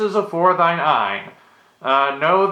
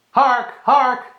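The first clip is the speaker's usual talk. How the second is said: calling out; 8 semitones higher than usual. Acted. Calling out to the guard.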